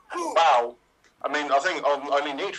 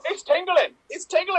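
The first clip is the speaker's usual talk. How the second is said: excited high voice; 12 semitones higher, 4 dB louder than usual. Acted. Improv scene, high loud.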